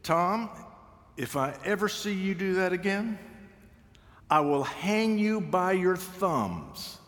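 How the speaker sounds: lightly voiced grandmother warning; stern grandmother. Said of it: "she would say to me".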